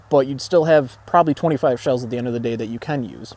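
usual speaking voice

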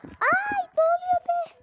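very high, loud scared cry; startled shriek. Acted. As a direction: yelp in sudden fright. Sudden fright.

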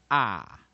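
啊 as a loud, open "ah"; short O sound. Shown as a demonstration. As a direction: call out 啊 as the model short-O vowel. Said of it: "O的短母音其实是念什么". (大声示范短母音 啊)

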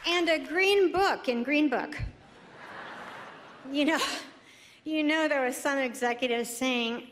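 ordinary delivery

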